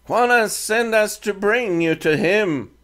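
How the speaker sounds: put-on a defiant teen speaking halting English; Comanche boy leader.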